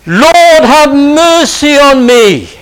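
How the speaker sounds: loud, high cry for mercy, drawn out slowly. Acted. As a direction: cry it out to the Lord like a beggar by the road. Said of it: "Cry out to him".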